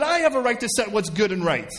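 high-pitched, insistent claim; self-assertion. Acted. Believer focused on himself claims the right, self-assertive.

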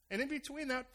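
usual speaking voice

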